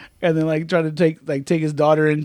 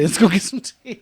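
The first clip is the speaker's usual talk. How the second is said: mock gentleman; 4 semitones higher than usual. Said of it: "call my homeboy darling".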